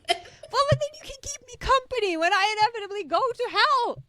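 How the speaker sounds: teasing. Real, not acted. Teasing reply, voice up.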